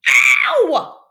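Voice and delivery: very high, loud shout of pain; pained yelp. Acted. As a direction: yelp as the splinter comes out. Patrick hurt by squeeze.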